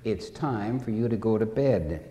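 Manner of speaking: low, soft, mildly insistent mother. Retold or imitated. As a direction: lightly voice a mother quietly pressing her child. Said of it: "But Mother is insistent".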